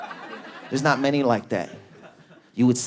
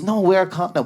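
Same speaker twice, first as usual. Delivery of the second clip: lightly voiced woman, pitched up; objecting girlfriend; 7 semitones higher than usual. Girlfriend objects to her lover, in a mock high voice. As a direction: imitate her objection in a higher voice. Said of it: "she goes".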